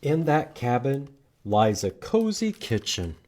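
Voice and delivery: ordinary talk, nothing marked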